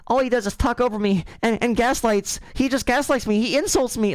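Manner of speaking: high whiny mock voice; mocking the caller. Mocking a whiny caller who got owned. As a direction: squeal it like a crybaby caller. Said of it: "Like, bro, brother, call back in".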